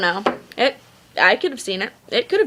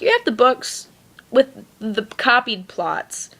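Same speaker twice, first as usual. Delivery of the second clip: ordinary talk, nothing marked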